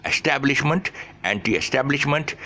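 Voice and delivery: "establishment" and "anti-establishment" recited high, beat on TAB; running stressed syllables. Shown as a demonstration. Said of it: "the stress syllables to you".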